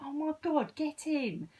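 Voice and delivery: high, delighted "get in!"; excited squeal of joy; thrilled. Retold or imitated. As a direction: cheer it like you've won. Thrilled her new guide appeared.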